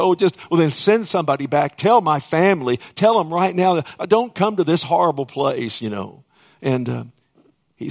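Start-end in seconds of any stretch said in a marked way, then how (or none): 0.0-5.8 raised, louder voice of a man begging; imitated desperate plea. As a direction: plead urgently, as the retold rich man. Rich man begs from Hades.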